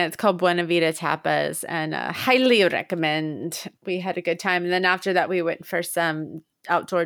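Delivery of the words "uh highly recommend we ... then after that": usual speaking voice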